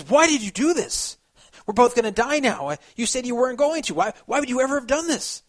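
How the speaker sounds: high, pleading cry of a betrayed frog; a sinking frog begging why; desperate frog. A narrator's reading. Dying frog pleads with scorpion.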